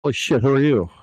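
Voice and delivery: sudden alarmed outburst; startled. Acted. NPC suddenly changes appearance.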